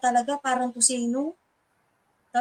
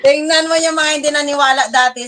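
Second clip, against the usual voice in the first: triumphant quote; 3 semitones higher, 9 dB louder than usual. Retold or imitated.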